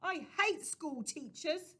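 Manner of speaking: put-on a boy yelling in terror; shouting. A narrator's reading. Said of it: "he shouted".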